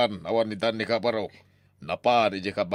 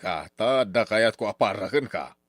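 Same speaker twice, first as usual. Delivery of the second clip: ordinary talk, nothing marked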